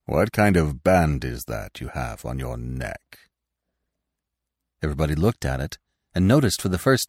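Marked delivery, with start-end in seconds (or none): 0.1-3.2 put-on low-pitched, grave old stag; deep, measured elder's question; quiet, commanding voice. A narrator's reading. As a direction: ask with quiet authority. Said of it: "asked in his quiet, commanding voice".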